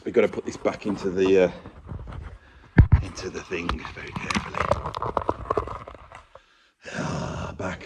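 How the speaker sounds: careful whisper. Real, not acted. Carefully moving heavy cabinet.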